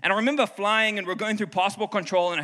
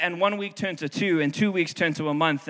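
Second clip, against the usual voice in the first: ordinary talk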